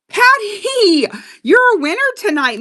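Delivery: high, loud celebratory call; excited winner call. Real, not acted. Host tells Patty she is tonight's winner, excited. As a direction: sing out the name, then announce the win. Calls out the winning buyer.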